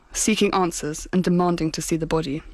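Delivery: ordinary talk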